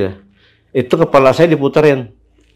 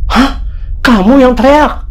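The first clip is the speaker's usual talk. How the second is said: high, louder astonished question; startled disbelief; 11 semitones higher, 3 dB louder than usual. Retold or imitated. Neighbourhood head learning who had screamed, imitated in startled disbelief. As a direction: jump in high and incredulous.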